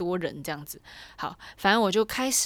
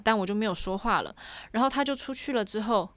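ordinary talk, nothing marked

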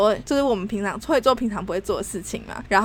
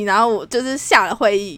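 usual speaking voice

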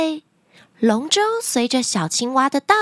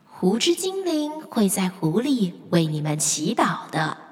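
lake spirit; 5 semitones lower, 4 dB quieter than usual. Acted. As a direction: speak gently as the lake spirit. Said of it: "传来湖之精灵的声音".

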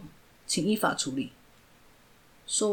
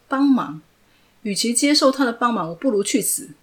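scornful refusal; 4 semitones higher, 6 dB louder than usual. Acted. Refuses help bitterly.

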